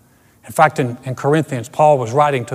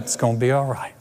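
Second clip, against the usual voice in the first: quiet, lower calm reply; soft reassurance; 5 semitones lower, 6 dB quieter than usual. Retold or imitated. Believer answering the doubter, softly reassuring. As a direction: settle the doubter with soft assurance.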